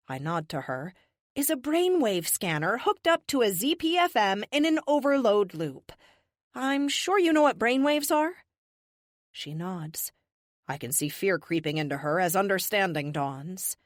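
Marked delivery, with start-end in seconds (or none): 1.4-8.4 higher-pitched, showy explaining to captors; defiant captive. Acted. Captive to the whole room, defiant reveal of her failsafe. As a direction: hold the room like a cocky prisoner.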